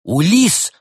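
loud surprised cry of a name; shout. A narrator's reading. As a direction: call out the name in shock. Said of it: "вскричал Евгений".

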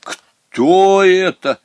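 high, loud, slow "Кто это?"; amazed. A narrator's reading. Headmistress sees the kid goat, astonished. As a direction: gasp out the question in astonishment. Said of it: "Директриса так удивилась".

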